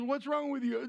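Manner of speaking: high, lightly voiced wife's question; hint of a puzzled wife. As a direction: play her confused reaction. Wife asks, voiced by him.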